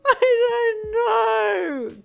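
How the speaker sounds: shrieked, very high drawn-out cry; wailing. A narrator's reading. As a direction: wail it through streaming tears. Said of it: "Kari wailed as tears streamed".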